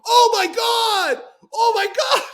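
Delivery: high, loud, slow panicked wail; horrified cry. Retold or imitated. Jack watching David jump, loud slow horrified cry. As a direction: cry out in horror. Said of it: "And Jack goes".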